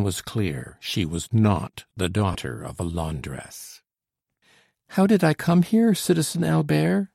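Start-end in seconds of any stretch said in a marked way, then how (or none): 4.9-7.1 put-on high, bright-pitched young woman's question; lightly voiced girl answering Albert; girl asking. A narrator's reading. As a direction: ask with feigned innocence. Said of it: "she asked".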